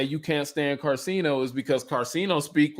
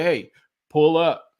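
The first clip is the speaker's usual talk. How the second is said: "Hey, pull up" slower, louder, every word weighted; mock firm challenge; 3 semitones higher, 5 dB louder, slower than usual. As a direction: lean into the dare, heavier. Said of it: "until somebody say".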